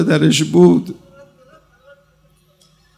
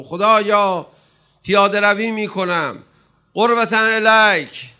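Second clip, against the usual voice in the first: loud declared intention; 5 semitones higher, 6 dB louder than usual. Shown as a demonstration. Preacher showing pilgrims how to voice their intention, loud. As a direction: voice raised, each phrase called out loud and higher. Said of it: "ببین صدا باید بلند باشه".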